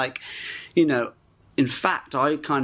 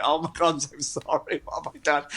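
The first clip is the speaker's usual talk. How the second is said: mortified apology; 2 semitones higher than usual. Retold or imitated. Retelling his apology to the mayor, mortified. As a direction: act out apologising, with a laugh breaking in. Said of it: "I had to apologise".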